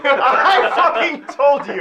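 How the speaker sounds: laughing through the words, slow. Real, not acted. Co-host laughs at the botched intro, laughing. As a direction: crack up while teasing him.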